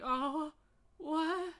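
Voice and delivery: high, loud shocked cry; mock-react as if struck by the news; high incredulous yelp; stunned disbelief. Reacting to her crying daddy.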